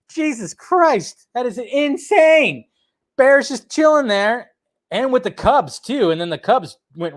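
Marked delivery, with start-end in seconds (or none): 0.1-2.6 higher, slow amazed disbelief. Real, not acted. Vlogger watching bear video, slow amazed disbelief. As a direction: drag it out in awe.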